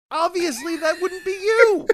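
high-pitched, laughing through the words. Real, not acted. Podcaster mocking the impostor scenario to co-host, laughing. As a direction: break up laughing as you speak.

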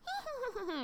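high, quiet goblin giggle; high-pitched imitated goblin titter; goblin laughter. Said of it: "little laughing and noises".

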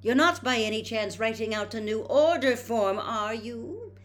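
a mother who sees right through them; shrewd. A narrator's reading. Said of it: "said Missus Weasley shrewdly".

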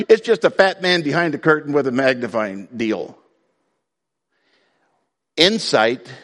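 ordinary talk

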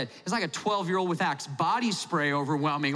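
ordinary talk

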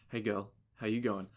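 low, soft mock come-on; mock flirting. Imagining a soap-covered man chatting up ladies, mock seductive. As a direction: murmur it like a would-be ladies' man. Mock pick-up line from soapy suitor.

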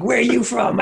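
high, yelling at the crowd; mock comedian yell; mock scream. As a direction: bark it at a heckler like a wild comic. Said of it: "they just scream at people".

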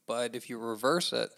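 normal talking voice

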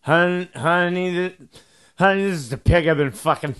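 put-on loud, slurring drunk, dragging the words; drunk husband. Drunk husband announcing himself to his wife, loud drunken slur. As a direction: bellow it slowly like a sloshed husband. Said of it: "he's drunk, and he says".

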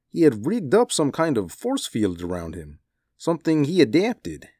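very high-pitched character voice; weary, marvelling Shirkov. A narrator's reading. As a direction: recount it high, with swooping pitch. Shirkov recounts the escape.

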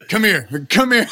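high, loud come here, rising each time; beckoning. Retold or imitated. Calling Clint over to teach him, high loud beckoning. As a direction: call him over eagerly. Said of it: "I was like".

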